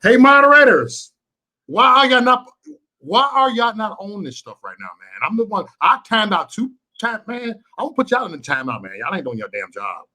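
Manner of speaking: ordinary talk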